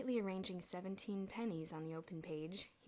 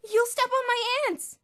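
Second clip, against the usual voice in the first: frantic shout; 17 dB louder than usual. Acted. Elmer frantic.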